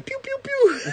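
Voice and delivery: very high laughing pew pew; toy gun sounds. Retold or imitated. Son playing with his Star Wars gun, high laughing blaster noises. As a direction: fire a pretend blaster with your voice.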